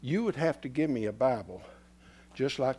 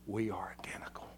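whispered We are identical; 5 semitones lower, 11 dB quieter, faster than usual. Acted. Preacher whispering the oneness of God to the church. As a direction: hush it to a whisper.

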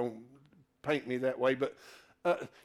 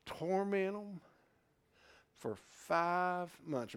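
softer, slow retold order; softer, slowed retelling of a command; retold command, hushed; 2 semitones higher, 5 dB quieter, slower than usual. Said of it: "he tells them".

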